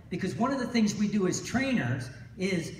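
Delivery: normal talking voice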